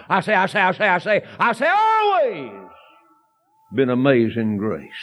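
preacher driving the point home; preaching build. Real, not acted. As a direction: hammer the phrase, then lift it. Preacher's insistent repetition.

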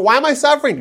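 high, loud mock lament; complaining sufferer. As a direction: play a sufferer demanding to know why. Acts out the sufferer's question.

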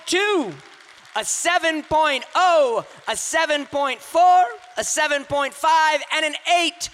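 high, rising host callout of the scores; announcing scores. Real, not acted. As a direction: read each score out to the whole room. Host reads scores to the crowd.

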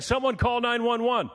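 high urgent mock shout; urgent call. Friend Sam urging someone to call for help, high urgent cry. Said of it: "Sam is saying".

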